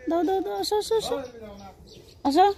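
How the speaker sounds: high, soft cooing; coaxing pet talk. Real, not acted. Asking the pet to rest its head, high soft coo. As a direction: coo to coax the animal close.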